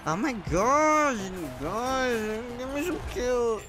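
excited. Real, not acted. Excited pleading for kills.

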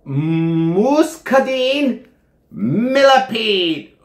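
very slow, high and drawn out; exaggerated sounding-out. Reviewer reading an unreadable flavor name, hammed-up slow sounding-out. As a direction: stretch each word out theatrically, pitch swooping up. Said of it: "I can't even read it".

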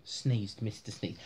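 ordinary delivery

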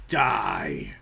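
low, drawn-out growl; menacing battle cry. Pretend. Player taking on a skeleton to kill it, menacing battle cry. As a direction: growl it at the enemy.

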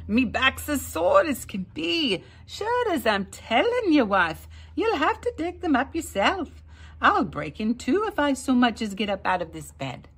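high, loud complaining lazy Irishman; whining. A narrator's reading. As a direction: moan about his sore back. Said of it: "Jamie would whine".

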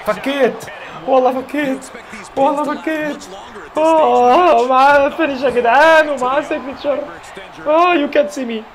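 high, loud thrilled yells; excited shouting. Real, not acted. Gamer thrilled at his finisher and signature. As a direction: shout in delight at the comeback.

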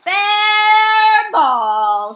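high, very loud umpire call; shouted. A narrator's reading. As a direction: bellow the call across the field. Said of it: "shouted the umpire".

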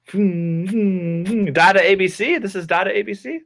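mock surprise. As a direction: feign amazement at the find. Reading surprise from basket.

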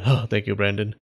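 usual speaking voice

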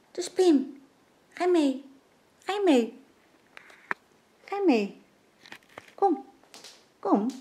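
high, sing-song voice for the dog; calling the dog. Real, not acted. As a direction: coax the dog along in a high, bright call. Calling the dog Pim to come. (hoge lokstem voor de hond)

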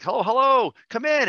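sing-song "come in" to a guest; cheery welcome. Retold or imitated. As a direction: greet the visitor with big excitement. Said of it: "And I'm like".

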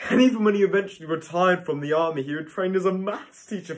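loud, high, rushed disbelief; incredulous outburst. Acted. Incredulous at his career change.

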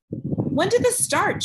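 high, slow and sharp; sharp question. Acted. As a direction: press him, sharp and slow. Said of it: "she asked sharply".

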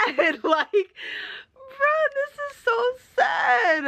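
very high, squeaky sobbing wail; real crying, voice leaping way up. Real, not acted. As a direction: wail it out slowly through tears. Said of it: "this book has me crying".

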